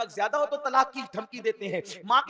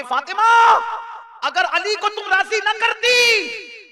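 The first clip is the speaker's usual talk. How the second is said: high, loud cry of her name and warning; anguished calling out; 9 semitones higher, 8 dB louder, slower than usual. Acted. Prophet calling out to his daughter Fatima, loud high anguished warning.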